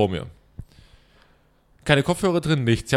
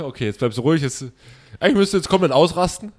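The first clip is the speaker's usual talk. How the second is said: usual speaking voice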